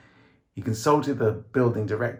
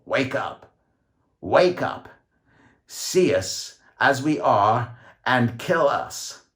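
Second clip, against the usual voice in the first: higher, louder croaking command; alien croak; 5 semitones higher, 6 dB louder, much slower than usual. A narrator's reading. George speaking as the alien to the city, loud croaking command. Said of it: "imitating the alien croak".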